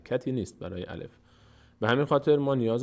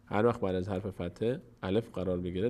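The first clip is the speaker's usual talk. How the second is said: ordinary talk, nothing marked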